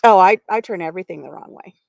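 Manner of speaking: ordinary delivery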